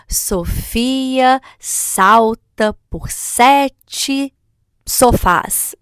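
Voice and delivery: very slow, deliberate word by word; alliteration demo. Shown as a demonstration. Playing with the child's own name, slow deliberate alliteration. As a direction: stretch out each word so the opening sound stands out. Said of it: "brincar com o próprio nome da criança".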